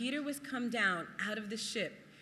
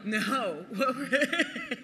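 ordinary delivery

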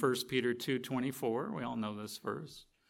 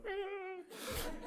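scared yelp; 8 dB quieter than usual. Retold or imitated. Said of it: "and you're like".

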